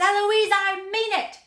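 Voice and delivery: high, very loud shout; angry tutor shouting. A narrator's reading. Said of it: "And then he shouts".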